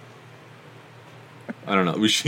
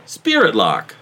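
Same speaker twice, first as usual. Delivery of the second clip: infomercial; 4 semitones higher than usual. Pretend. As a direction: pitch the product like a TV ad. Mock ad continues.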